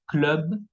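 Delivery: "club" as klœb, final B sounded; English-style vowel. Shown as a demonstration. French teacher models the English loanword, "club" as klœb. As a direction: say "club" close to English, with a clear B. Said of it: "pronunciamos como en inglés".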